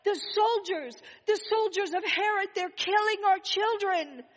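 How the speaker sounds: loud, high, panicked alarm; desperate cry. A narrator's reading. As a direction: shout the warning in terror, fast. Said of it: "a desperate voice cried out".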